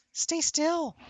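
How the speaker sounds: put-on very high baby talk begging the cat to hold still; squeaky pet voice. Coaxing the squirming cat.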